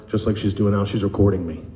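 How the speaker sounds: ordinary talk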